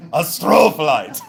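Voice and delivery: "astrophyllite" boomed as uh-STROOO-fuh-lite; hammed-up "astrophyllite", hard on STRO; imitated yell. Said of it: "but he says, one more time".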